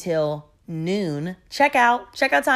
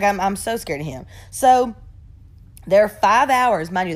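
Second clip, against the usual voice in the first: ordinary talk, nothing marked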